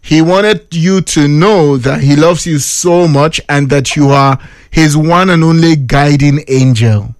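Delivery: slow, low, suave proper English; polished English. Pretend. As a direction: woo on a friend's behalf in polished English. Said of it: "you just watch the English wey I go speak".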